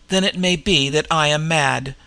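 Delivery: ordinary delivery, nothing marked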